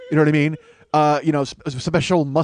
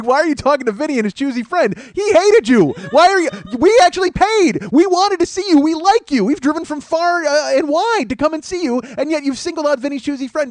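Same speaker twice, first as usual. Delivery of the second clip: high, loud complaining crowd voice; annoyed audience; 12 semitones higher, 5 dB louder than usual. Retold or imitated. Audience griping at the host, high indignant whine. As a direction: whine like paying fans who feel snubbed. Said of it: "They're like".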